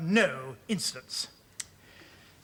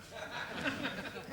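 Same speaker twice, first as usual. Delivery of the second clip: breathy giggle; 6 semitones higher, 13 dB quieter than usual. Acted. Boss laughs at his own joke.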